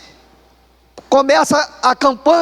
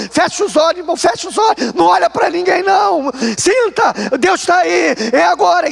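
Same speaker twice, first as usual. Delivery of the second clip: fervent revival pastor cry; mock preacher urging; 6 semitones higher than usual. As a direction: urge the crowd to feel God now. Pastor urges in service.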